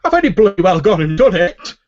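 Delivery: loud, high cry of triumph; triumphant shout. Acted. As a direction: yell it out in delight. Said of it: "Phil's, from inside the shuttle going".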